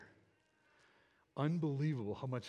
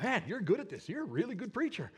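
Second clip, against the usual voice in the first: high, lilting voice of an admirer; imitated congratulations, pitched up; enthusiastic praise; 7 semitones higher than usual. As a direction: gush like an impressed fan. Admirers praising him in story.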